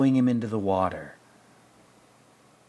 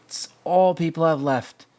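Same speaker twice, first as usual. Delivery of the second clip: impassioned cry; 8 semitones higher, 9 dB louder than usual. Retold or imitated. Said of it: "And he's like".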